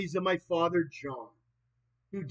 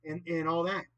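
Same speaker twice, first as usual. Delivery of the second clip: ordinary delivery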